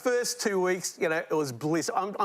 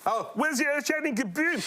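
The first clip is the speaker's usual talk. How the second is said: high cartoon Martian voice; Marvin the Martian; 9 semitones higher, faster than usual. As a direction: perform a puzzled cartoon alien. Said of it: "Marvin's line".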